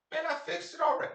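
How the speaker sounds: put-on high, mouthy kid talking back; lightly voiced sassy child. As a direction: sass your mother like a cocky kid. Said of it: "I didn't say it like that".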